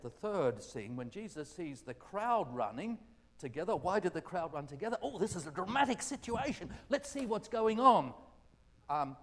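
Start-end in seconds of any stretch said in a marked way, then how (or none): 5.0-8.1 high, loud burst of curiosity; excited onlooker. Acted. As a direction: play a crowd rushing over to look. Acting out the curious gathering crowd.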